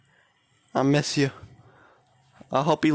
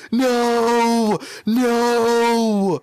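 loud, high panicked scream; 6 semitones higher, 5 dB louder than usual. Real, not acted. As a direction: yell it out in horror. Birdie boy falls to danger.

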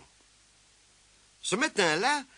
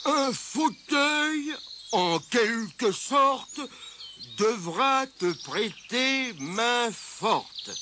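very slow, higher-pitched recital; portentous riddle; 6 semitones higher, much slower than usual. A narrator's reading. Mole gives Tirondin the riddle, portentous recital. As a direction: spell it out slowly as a clue.

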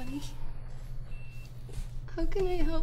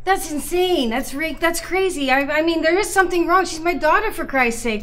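frustrated insistence; 4 dB louder than usual. Acted. Mother insists daughter is sick.

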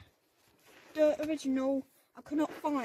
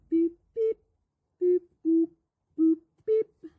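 mock computer beeps, louder; robot beeping; 3 semitones higher, 8 dB louder than usual. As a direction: beep boop like a robot. Mimicking a computer booting up.

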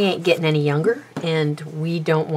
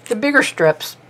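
talking around a pin held in mouth; words blocked by a pin between lips; 6 semitones higher than usual. Real, not acted. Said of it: "can't talk with a pin in my mouth".

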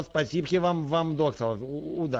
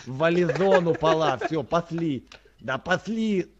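put-on loud hurried calls, accented; urgent shouting; 3 semitones higher, 7 dB louder than usual. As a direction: shout to hurry him out the door. Accented player hurries his brother along.

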